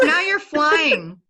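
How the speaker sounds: high, loud, drawn-out delight; delighted amazement. Real, not acted. Host watching the therapist fly on screen, delighted. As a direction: call it out in high-pitched wonder.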